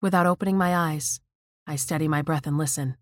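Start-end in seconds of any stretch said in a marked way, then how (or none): none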